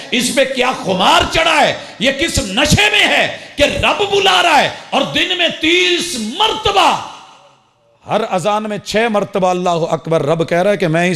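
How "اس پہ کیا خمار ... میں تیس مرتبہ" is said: rebuking shout. Real, not acted. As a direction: thunder at the heedless crowd. Preacher rebuking heedless listeners.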